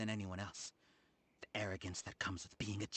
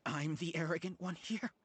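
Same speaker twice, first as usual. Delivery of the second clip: incredulous; 8 semitones higher than usual. Acted. Rival stunned at being called the arrogant one, incredulous. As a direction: throw the accusation back in disbelief.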